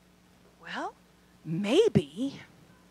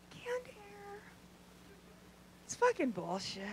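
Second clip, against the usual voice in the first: much softer, flat "Canned air?" aside; deadpan; 9 semitones higher, 17 dB quieter, slower than usual. Real, not acted. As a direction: drop "Canned air?" to a quiet mutter.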